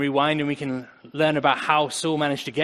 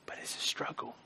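whisper; 14 dB quieter than usual. Real, not acted. Sermon, confessing struggle.

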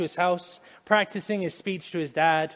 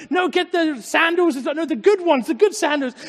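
very high, loud rapid-fire orders; so excited father; 12 semitones higher, 7 dB louder than usual. Acted. Father too excited to hear his son's apology, exuberant. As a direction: call out orders in overjoyed excitement. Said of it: "he was just so excited".

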